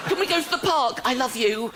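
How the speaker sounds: mock little kid. Little kid asking the builder to go to the park, eager. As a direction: ask it like an eager small child.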